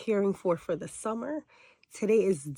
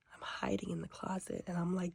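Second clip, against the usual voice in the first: hushed whisper in a closet; whispering while hiding; 11 dB quieter than usual. Real, not acted.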